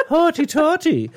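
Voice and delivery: high, slow, giggly put-on voice; sing-song it high and slow; mock hoity-toity. Joking about the loo, high mock-snooty voice. Playing along with silly loo voice.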